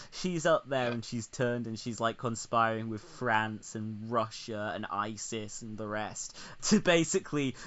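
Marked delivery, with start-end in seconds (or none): none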